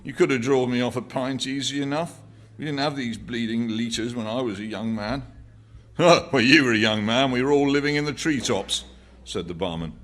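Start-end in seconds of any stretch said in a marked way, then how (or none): 0.0-5.2 put-on higher, aggrieved cockney old man; grumbling old man. A narrator's reading. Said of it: "persisted the old man". 6.0-8.8 loud, higher jeering barman; mocking barman. A narrator's reading. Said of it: "with a glance at the other customers".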